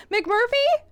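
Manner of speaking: loud worried call into the silence. Acted. Searching for her lost friend, loud anxious call. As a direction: call his name, high and anxious.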